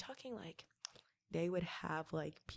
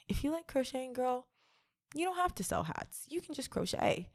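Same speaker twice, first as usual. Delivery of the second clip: sassy; 7 semitones higher than usual. Real, not acted. Addresses listener as "girl.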